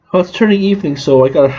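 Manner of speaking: usual speaking voice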